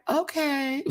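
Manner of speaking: high-pitched, drawn-out "Okay"; squeaky-high "okay"; re-enacted first reaction. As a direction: replay an unsure reaction way up high. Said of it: "Originally, this is me".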